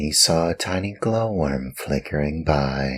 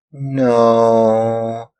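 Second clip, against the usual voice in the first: small guilty no; 8 semitones higher, 6 dB louder than usual. Acted. Puppet answers glowworm.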